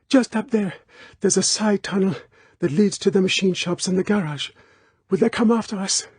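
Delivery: put-on high breathless girl voice. A narrator's reading. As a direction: gasp it out in a hurry. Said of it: "She said breathlessly".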